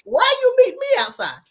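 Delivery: high, loud, incredulous challenge; retold indignant comeback. Student answering a lunchroom bully, acted-out indignation.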